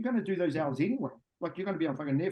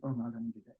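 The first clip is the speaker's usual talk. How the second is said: low, soft mock whine of a lazy worker; mock the reluctant worker, a bit lower and softer; whiny employee; 4 semitones lower, 4 dB quieter, much faster than usual. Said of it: "They go".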